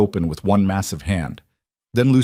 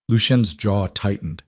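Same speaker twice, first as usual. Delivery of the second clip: ordinary delivery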